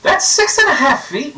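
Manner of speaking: high, loud amazed exclamation; surprised. Real, not acted. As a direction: blurt it out in disbelief. Amazed at the size.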